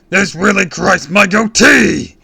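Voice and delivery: very loud, high, slow villain bellow. A narrator's reading. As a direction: bellow it in comic rage. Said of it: "he bellowed".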